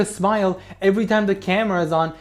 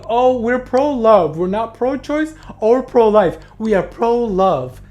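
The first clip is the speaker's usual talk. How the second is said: higher, slow, sugary mock of the couple; mocking pro-love couple; 6 semitones higher, 5 dB louder than usual. Vlogger mocking the couple's pro-love line, hammed-up sugary voice. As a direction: ham up their sweet slogan with a sneer. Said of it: "But don't come out here saying".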